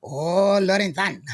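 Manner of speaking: drawn-out mock Japanese accent greeting; exaggerated Japanese accent. Host greeting his friend in Japan, mock Japanese accent. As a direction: put on a playful, stretched Japanese accent. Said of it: "I like using that Japanese accent".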